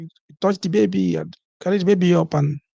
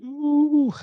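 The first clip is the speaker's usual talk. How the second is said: high, held exclamation; high drawn-out "ooh"; 10 semitones higher than usual. Acted. Parent recalling the babies' crying protest, high drawn-out ooh. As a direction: let out a long, high "ooh".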